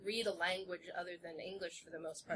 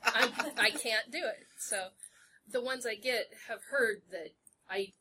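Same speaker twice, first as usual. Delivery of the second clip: ordinary talk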